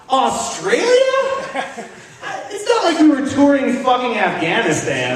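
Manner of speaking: high, indignant disbelief; incredulous outrage. Real, not acted. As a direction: rant in disbelief. Indignant rant.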